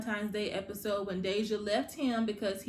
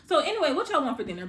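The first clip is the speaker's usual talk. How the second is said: higher, louder and fast, brushing it off; parents changing subject; 5 semitones higher, 7 dB louder, much faster than usual. Retold or imitated. Parents dodging Kevin's request, quick bright deflection. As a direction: rush breezily past the topic. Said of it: "So Dre and Nina are like".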